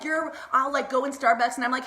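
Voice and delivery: ordinary delivery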